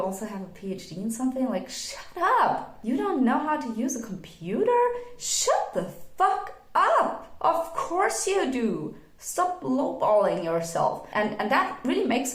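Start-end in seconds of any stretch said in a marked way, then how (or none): 1.5-11.1 higher and slow, incredulous and fed up; exasperated outburst. Real, not acted. Scolding people with a PhD who claim they can't use computers, exasperated and high. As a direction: shut them down, half laughing in disbelief. Exasperated rant at self-doubters.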